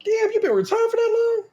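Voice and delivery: high incredulous retelling; shocked disbelief. Retold or imitated. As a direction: gasp it out like you can't believe it. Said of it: "but I was like".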